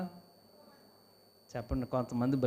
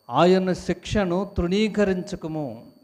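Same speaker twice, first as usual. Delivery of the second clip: slow, louder scripture recitation; solemn scripture; 5 semitones higher, 6 dB louder, much slower than usual. Acted. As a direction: proclaim the verse slowly. Preacher quoting scripture. (ప్రసంగంలో నెమ్మదిగా గట్టిగా వాక్యం చదవడం)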